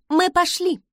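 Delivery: high, strained politeness; stiffly cheerful. A narrator's reading. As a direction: announce it through a wooden smile. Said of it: "изобразила я слегка деревянную улыбку".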